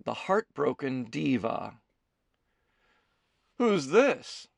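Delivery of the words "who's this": high, eager question; keen to know the stranger; with great interest. A narrator's reading. Kelsey eyes the stranger, asking with keen interest. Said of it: "asked with great interest".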